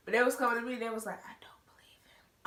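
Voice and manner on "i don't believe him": unvoiced secret whisper; whisper aside. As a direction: whisper it so he can't hear.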